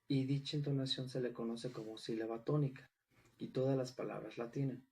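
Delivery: ordinary talk, nothing marked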